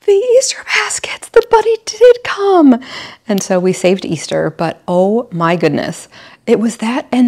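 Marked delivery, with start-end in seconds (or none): 0.1-2.8 very high, breathy then louder, slowed; mock amazement. Mom pretending the bunny came, acting amazed for the kids. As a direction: gush with fake wonder. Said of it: "I'm like".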